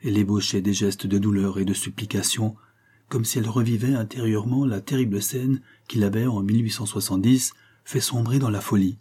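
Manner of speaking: plain reading voice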